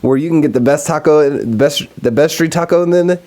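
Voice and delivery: announcing a fake taco sponsor, mock ad voice; mock ad read. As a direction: pitch the taco stand like a cheesy radio ad.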